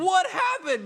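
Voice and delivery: very high, loud imitated dismay; mock shock. Owner finds her bird naked, mock-horrified high cry. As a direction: exclaim it like an appalled owner. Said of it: "And she's like".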